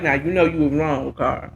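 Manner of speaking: lightly voiced friend scolding Carl; low scolding friend. Friend scolds Carl about starting the mess, low and stern. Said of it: "I want my friends to be like".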